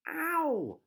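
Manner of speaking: high, loud yelp; cry of pain. Acted. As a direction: Rapunzel yelping as her hair is tugged. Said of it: "cried Rapunzel".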